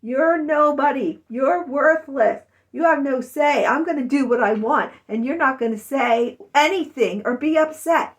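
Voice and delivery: harsh scolding parent. Retold or imitated. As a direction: yell it down at a hurt child. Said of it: "That's what my parents told me".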